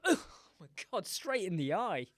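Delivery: hammed-up shocked yell; hit bystander's outcry. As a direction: cry out as if struck in the eye. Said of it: "they're gonna go".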